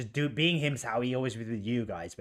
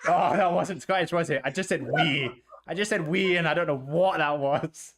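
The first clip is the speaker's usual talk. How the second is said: higher, talking through laughter; real laughing; 4 semitones higher, 4 dB louder than usual. Real, not acted. Co-host breaking character over a botched accent, laughing. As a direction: crack up at your own failed attempt. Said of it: "It's 'cause I'm laughing".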